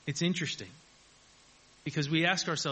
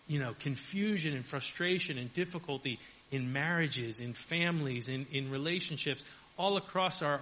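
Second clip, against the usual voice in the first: ordinary talk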